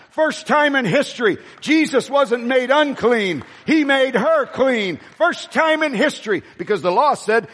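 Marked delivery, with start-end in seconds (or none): 0.1-5.0 higher, fervent proclaiming; preaching emphasis. Real, not acted. Preacher emphasizing key point. 5.2-6.4 higher, fervent proclaiming; preaching emphasis. Real, not acted. Repeated climax.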